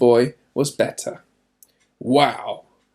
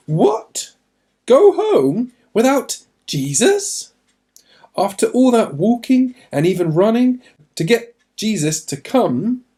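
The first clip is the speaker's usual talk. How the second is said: astonished disbelief; 8 semitones higher, 4 dB louder than usual. Acted. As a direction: sound like you can't believe what you heard. Narrator voices the father's disbelief.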